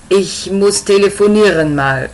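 put-on broken German, Russian accent. Semjonow speaks broken German.

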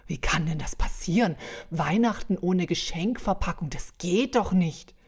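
loud, indignant outburst; loud exasperation. Acted. Santa facing Christmas without wrapping, ranting loudly. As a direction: rail loudly at the disaster.

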